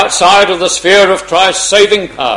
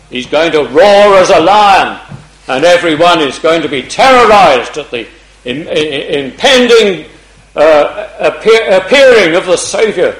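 louder, stumbling but urgent preacher; louder preaching; 6 dB louder than usual. Acted. Preacher warning of the Lord's return, louder. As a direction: build it up to warn them.

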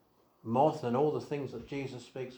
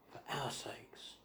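hushed whisper; 13 dB quieter than usual. Real, not acted. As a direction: breathe it out in a slow whisper. Preacher drops to hushed emphasis.

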